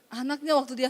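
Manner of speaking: ordinary delivery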